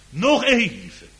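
fervent pulpit cry; loud slow cry. Acted. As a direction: call it out across the church. Preacher cries out promise.

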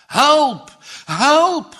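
high desperate screams; screaming for help. Acted. Drowning boys scream to the empty fields, desperate shouts. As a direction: scream for help as the ice breaks. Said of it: "gillen ze".

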